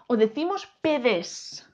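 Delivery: "pedes" lento, sílaba a sílaba; alternativa errónea de prueba. Shown as a demonstration. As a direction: ofrece "pedes" como opción dudosa, alargándolo. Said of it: "¿Yo qué digo?". ("pedes" estirado, marcando la de)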